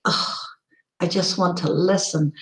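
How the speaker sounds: ordinary talk, nothing marked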